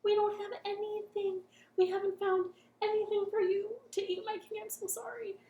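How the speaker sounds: high, timid apologetic chefs; sorry monster chefs. Acted. Chefs apologising to their hungry king.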